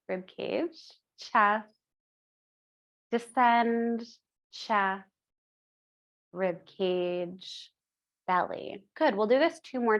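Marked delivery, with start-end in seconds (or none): 0.1-8.8 drawn-out words with long pauses; slow the breath cues down. Shown as a demonstration. Teacher slowing the class's breathing, unhurried guided cues. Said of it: "try to slow it down".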